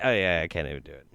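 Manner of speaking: ordinary talk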